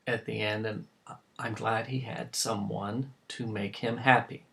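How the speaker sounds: hushed, low sincerity; subdued, halting gratefulness; tender gratitude. Acted. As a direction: speak softly about a painful loss. Father grateful son was not alone.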